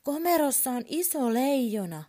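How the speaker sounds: put-on high, loud alarmed little boy; child calling out. A narrator's reading. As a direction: shout a warning to dad. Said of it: "hän huutaa".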